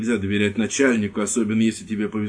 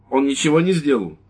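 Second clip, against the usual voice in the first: defiant wife; 5 semitones higher, 5 dB louder than usual. Acted. Wife defends husband.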